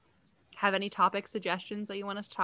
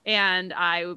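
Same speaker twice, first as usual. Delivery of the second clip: usual speaking voice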